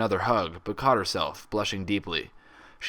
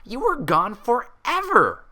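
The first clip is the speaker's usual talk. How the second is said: high, loud, slow squeal of joy; excited squeal; 14 semitones higher, 6 dB louder, slower than usual. A narrator's reading. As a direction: squeal like a giddy friend reunited. Pinkie squealing in tight hug.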